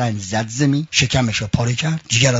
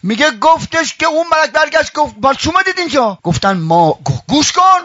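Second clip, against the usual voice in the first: raised, sing-song preaching voice carrying the tale; sermon preaching; 13 semitones higher than usual. Acted. Preacher telling mourners the angels' tale, in full preaching voice. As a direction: preach it out to the whole hall.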